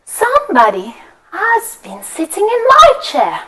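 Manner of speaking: lightly lifted mama bear. Acted. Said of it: "She says".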